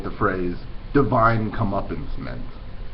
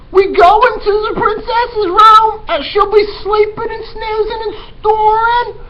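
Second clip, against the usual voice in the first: put-on high, loud, brash girl voice; eager Mig; 10 dB louder than usual. Teacher voicing dim servant girl Mig, high and loud. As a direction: pitch way up and blurt it out loud. Mig recites her plan.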